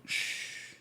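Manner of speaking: a soft, breathy shush; quick hushing hiss. Retold or imitated. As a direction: hush him like a cop at a bedside. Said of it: "like that".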